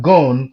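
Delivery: "gone" said loud and falling; stress on gone. Shown as a demonstration. Teacher showing the strong beat on "gone". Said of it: "tells you there's a strong beat there".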